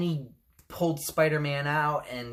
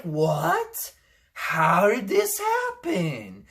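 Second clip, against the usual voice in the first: mock fan shock; much slower than usual. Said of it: "Everyone's like".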